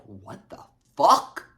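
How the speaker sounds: slow, loud "what the fuck"; retold disbelief. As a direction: draw it out, punching the last word. Shocked reaction to money text.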